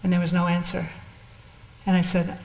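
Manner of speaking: ordinary delivery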